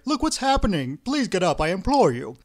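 high, loud panicky plea; frightened pleading. A narrator's reading. Engineer begging the priest to get up, frightened pleading. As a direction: implore loudly in alarm. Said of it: "shouted the frightened engineer".

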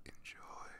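breathy whispered sign-off. Acted. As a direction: lean in and whisper it close to the mic. Closing the intro of the audio.

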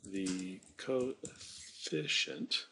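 slowly drawn out. Real, not acted. Teacher typing the word for students, drawn out slow. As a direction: stretch each syllable of the word out slowly.

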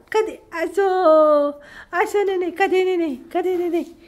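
high, playful coo. Real, not acted. Grandma plays peekaboo with her kitty, high playful coo.

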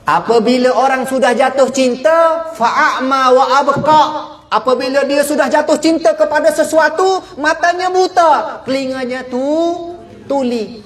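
fervent preacher voice; preaching at full force. Acted. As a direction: drive the point home to the congregation. Preaching on love's blindness.

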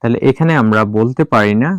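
ordinary delivery

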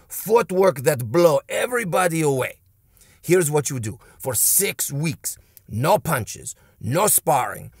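slow, emphatic fake Russian coach; bad Russian accent. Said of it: "a bad Russian accent, but play along".

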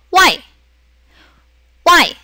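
와이 falling sharply from high; fourth tone. Shown as a demonstration. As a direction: say 와이 in Mandarin fourth tone. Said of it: "그러면 사성에서".

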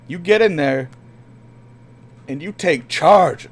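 urging shout. Real, not acted. Cheering the game character on, loud. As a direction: pump them up, shouting.

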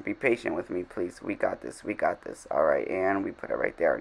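soft self-coaxing under the breath; quiet muttering to self. Real, not acted. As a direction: murmur it to yourself, patiently. Coaxing herself through fiddly placement.

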